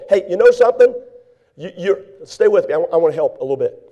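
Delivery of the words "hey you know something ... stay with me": usual speaking voice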